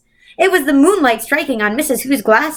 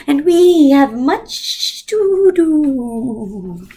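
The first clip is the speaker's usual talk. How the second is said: high, very slow eerie voice; strange voice; 6 semitones higher, much slower than usual. A narrator's reading. Mrs Witch scolding the other two, high slow strange voice. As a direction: drawl it out like a ghost. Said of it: "the voice said".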